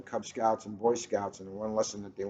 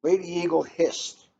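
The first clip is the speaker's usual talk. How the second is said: measured storybook reading, a bit brighter and louder than chat; story narration; 4 semitones higher, 6 dB louder than usual. A narrator's reading. Host reading a picture book to listeners, storybook narration. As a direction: read the tale aloud to listeners, carefully paced.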